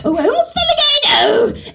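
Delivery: very high, gasping, flustered maid; klutzy Mei Rin. Acted. Actor improvising the clumsy maid running off screen, flustered. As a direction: stumble along in squeaky little oh's and gasps. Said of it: "and she's klutzy".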